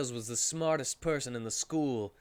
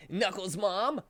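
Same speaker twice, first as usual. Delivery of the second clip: higher, put-on hero voice; Sonic character voice; 7 semitones higher than usual. Narrator voicing Sonic to friends, higher hero voice. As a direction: voice Sonic rallying the group.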